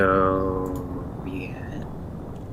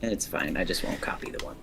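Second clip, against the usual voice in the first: unvoiced mutter to self; whispering; 5 semitones lower, 13 dB quieter than usual. Real, not acted. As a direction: whisper it under the breath.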